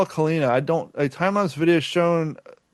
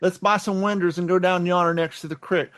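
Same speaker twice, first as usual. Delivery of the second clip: put-on Appalachian accent; 3 dB quieter than usual. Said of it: "hillbilly accent".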